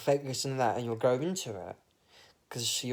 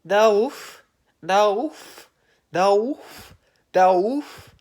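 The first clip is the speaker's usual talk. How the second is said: high, loud repeated calling of a name; girlfriend calling; 8 semitones higher, 11 dB louder, much slower than usual. Retold or imitated. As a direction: call his name over and over, high and loud, like a nagging girlfriend. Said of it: "my girlfriend keeps calling me".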